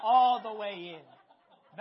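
loud, slow laugh through the words; a loud, slow laughing admission. Real, not acted. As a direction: laugh out loud while owning it. Laughing at his own confession.

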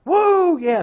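loud, very high whoop; mock stadium roar, high and loud; crowd shouting. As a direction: cheer like thousands of fired-up men. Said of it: "fifty thousand men shouting".

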